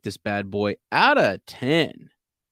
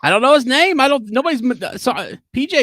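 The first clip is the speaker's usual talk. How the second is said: flustered; 12 semitones higher, 6 dB louder, faster than usual. Acted. Flustered under caller's pressure.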